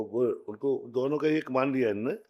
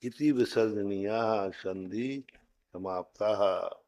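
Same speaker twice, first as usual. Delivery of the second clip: slow formal recitation; 3 semitones lower, slower than usual. Shown as a demonstration. Teacher closing the chapter, slow formal recitation. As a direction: read the closing line slowly and formally.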